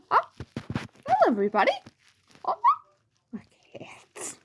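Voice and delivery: high little cartoon greeting, fading to whisper; high, halting cartoon mouse voice; Mickey. Shown as a demonstration. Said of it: "I do Mickey".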